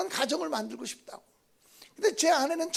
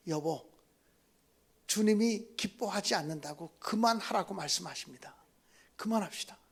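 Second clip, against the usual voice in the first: gentle, hushed plea; 6 semitones lower, 5 dB quieter than usual. Retold or imitated. Pastor calmly tells wife to stop.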